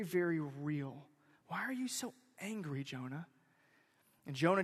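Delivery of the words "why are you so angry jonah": soft, searching question; hushed question. Acted. Preacher gently questioning angry Jonah, hushed. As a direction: ask it quietly, sinking on the name.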